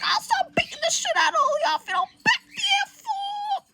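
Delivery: loud, very high angry yell; fed-up threat. Acted. Ben not having this bullshit, yelling a threat. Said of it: "Ben's not having this bullshit".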